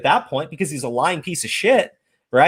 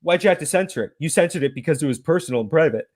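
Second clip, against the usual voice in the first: mocking quote; 6 semitones lower, faster than usual. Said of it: "turns around and says".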